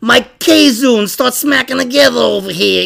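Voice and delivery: high-pitched Italian guy from New York; Italian-American mook. Acted. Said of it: "That's a mook".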